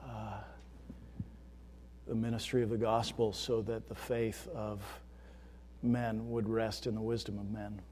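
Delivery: soft, measured pastoral prayer. Real, not acted. Pastor praying for the congregation before the sermon, hushed prayer. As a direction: pray quietly and reverently, with long pauses.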